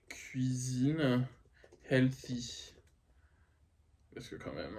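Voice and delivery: unvoiced conspiratorial whisper. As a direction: whisper it like a secret aside. Whispered aside about healthy food.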